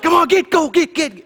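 very high, loud shooing; frantic shooing shout. Retold or imitated. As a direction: yell at the cow to move. Shooing the cow harder.

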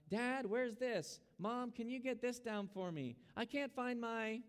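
high-pitched, drawn-out calls to Mom and Dad; imitated needy child. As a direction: act out a child pleading for a parent's help. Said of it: "That's what a child does".

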